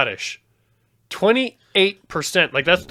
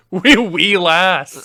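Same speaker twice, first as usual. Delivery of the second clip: put-on Scottish accent; 3 semitones higher, 5 dB louder, much slower than usual. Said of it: "Scottish Viking".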